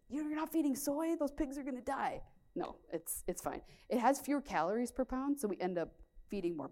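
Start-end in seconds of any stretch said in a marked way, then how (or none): 0.1-2.2 mock shocked, higher voice; a touch of mock panic; alarmed American farmer. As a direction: parody the alarmed neighbour. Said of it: "it's like".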